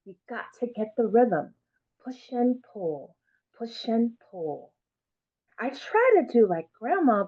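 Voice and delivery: put-on lively read-aloud, lifting for the child's voice; story reading. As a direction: read it warmly to a class. Reads picture book aloud.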